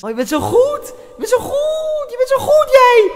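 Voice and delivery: very high, squealed sarcastic compliment; mock praise. Mocking the opponent's goal, high sarcastic squeal. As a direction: gush at him in a high mocking falsetto.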